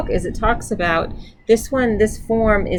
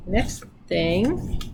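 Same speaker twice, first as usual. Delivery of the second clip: ordinary delivery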